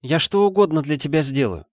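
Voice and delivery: high pleading promise; child begging. A narrator's reading. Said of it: "заклянчила жалобным голосом". (жалобная мольба)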